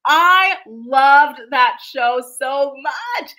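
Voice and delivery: loud, slow, heartfelt enthusiasm; emphatic gushing. Real, not acted. Fan begging for Indian matchmaking back, loud emphatic gushing. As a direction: belt out each word with love. Gushing over a favourite show.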